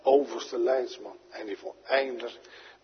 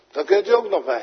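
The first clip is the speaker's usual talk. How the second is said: louder and higher, stress on u; fervent louder restatement; emphatic repeat; 6 semitones higher, 7 dB louder, faster than usual. Shown as a demonstration. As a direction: proclaim "u" as a bold promise. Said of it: "repeats it emphatically".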